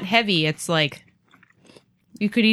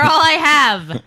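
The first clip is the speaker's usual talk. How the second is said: laughing; 7 semitones higher, 6 dB louder, much slower than usual. Real, not acted.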